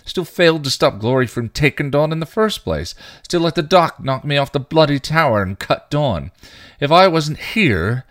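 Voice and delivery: high, loud anguished yelling; yelled. A narrator's reading. Spike yelling at Giles about his failures, anguished shout. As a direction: scream the guilt out at him. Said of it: "he yelled".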